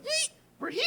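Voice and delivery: very high, squeaky mock glee; excited arrival squeal. Acts out Shelley announcing arrival.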